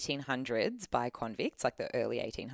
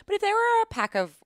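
sudden emphatic rise; 7 dB louder than usual. Real, not acted. Heated aside.

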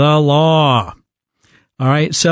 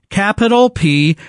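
ordinary delivery

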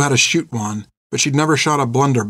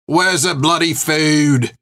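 slow, raised-pitch angry call; 4 semitones higher, slower than usual. A narrator's reading. Hungry soldier to the kitchen, calling out angrily. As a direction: call out crossly, stretching the words. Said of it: "called an angry voice".

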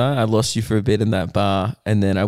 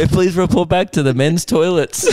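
mock announcement, laughing; 7 semitones higher than usual. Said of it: "they make an announcement".

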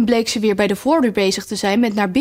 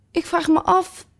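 curious rose; 6 semitones higher than usual. Acted. Rose wonders about Alice's points.

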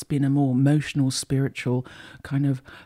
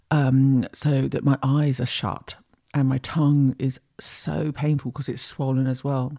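normal talking voice

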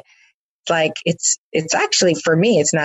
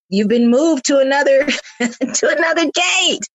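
amused announcement; 10 semitones higher than usual. Retold or imitated. Reading the gate banner, amused.

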